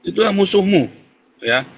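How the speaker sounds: normal talking voice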